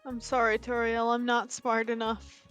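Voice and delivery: ordinary talk, nothing marked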